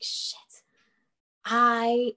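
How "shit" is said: whispered under the breath; whispered curse. Retold or imitated. Realising she was caught lying, a whispered curse.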